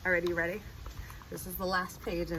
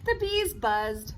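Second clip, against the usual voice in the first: put-on slow, sing-song reading to children; storytime reading; 5 dB louder, much slower than usual. A narrator's reading. Reading a picture book to kids, slow storytime voice. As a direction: read it like a bedtime picture book.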